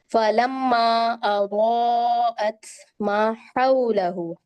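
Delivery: very slow melodic Arabic recitation, full au in हौलहु; Quran recitation. Shown as a demonstration. Teacher recites the verse slowly as a model. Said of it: "दूसरी आयत में देखें".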